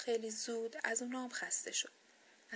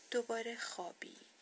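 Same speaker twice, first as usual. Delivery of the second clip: usual speaking voice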